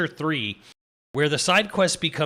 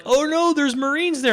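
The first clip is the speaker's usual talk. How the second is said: much higher, louder hammed-up panic; mock scared; 12 semitones higher, 4 dB louder than usual. Imitating the cowardly sniper panicking at the Marines. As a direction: play a coward squealing in fright. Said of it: "He gets scared of, like".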